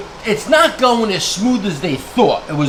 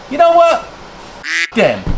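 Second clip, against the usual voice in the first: heated outburst; slower than usual. Real, not acted. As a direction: throw it out like a challenge. Heated rant build-up.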